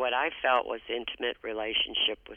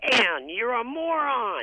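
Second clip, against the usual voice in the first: mock insult; 10 semitones higher, 4 dB louder, slower than usual. Mother insulting daughter out of blue.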